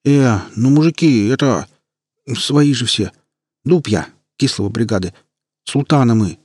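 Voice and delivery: a scared street punk backpedalling; frightened. Acted. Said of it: "испуганно заговорил гопник".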